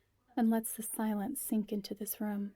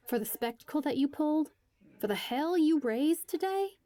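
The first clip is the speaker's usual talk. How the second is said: higher, louder furious accusation; much higher and louder, outraged; angry; 7 semitones higher, 5 dB louder than usual. Acted. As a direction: lay into him for the stunt. Angry at Jonah's stunt.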